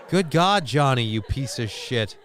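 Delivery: normal talking voice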